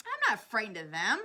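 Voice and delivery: loud, defiant shout. A narrator's reading. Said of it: "shouted the centipede".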